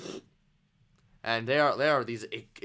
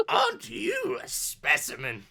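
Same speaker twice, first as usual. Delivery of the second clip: put-on high-pitched, admiring old lady; flirty granny; 9 semitones higher than usual. Granny sizes up Dorg at the bar, flirty.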